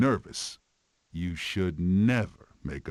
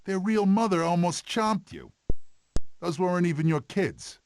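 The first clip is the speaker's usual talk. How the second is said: incredulous; 10 semitones higher, 3 dB louder than usual. Real, not acted. Mocking Sid's story.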